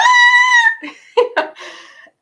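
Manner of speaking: high shrieking laugh; real laughter at her own bluff; loud burst of laughter. Real, not acted. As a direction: burst out laughing loudly at the memory.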